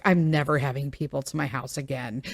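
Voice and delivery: low, soft deadpan muttered resolve; deadpan vow. Retold or imitated. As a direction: say it flatly under your breath, half joking. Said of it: "I was just like".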